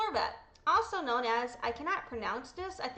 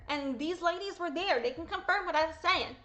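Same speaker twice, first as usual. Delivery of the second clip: ordinary talk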